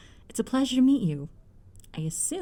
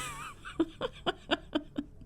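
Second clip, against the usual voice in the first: teasing laugh; 11 semitones higher, 6 dB quieter than usual. Acted. Mother teasing son.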